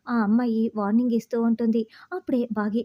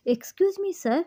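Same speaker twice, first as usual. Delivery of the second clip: higher, put-on innocent wife's voice; Bagi pleading innocently; 8 semitones higher, much slower than usual. Bagi to the terrorist boss, higher innocent pleading voice. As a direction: play the wide-eyed innocent housewife. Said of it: "అని బాగి చెప్తుంది".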